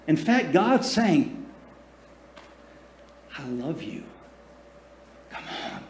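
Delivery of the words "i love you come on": much softer, lower, almost a whisper; tender and hushed. Shown as a demonstration. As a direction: coax gently like a loving father. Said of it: "God is not saying it with a loud".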